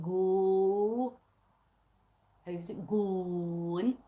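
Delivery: low, slow "Go" with a long drawn o; deep, drawn-out "going" imitation; imitated Scottish attempt. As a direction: mimic your friend stretching the o in "going". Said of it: "when she said".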